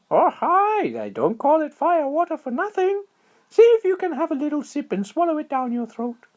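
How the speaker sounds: very high, excited little squirrel voice; high squeaky critter voice. A narrator's reading. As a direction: chirp it out like a tiny squirrel. Squirrel voice held.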